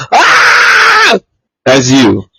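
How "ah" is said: much higher panicked yelp; mock freak-out. Imitating Buzz panicking in game, mock yelp. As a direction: squeal in fake panic. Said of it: "she's still freaking out".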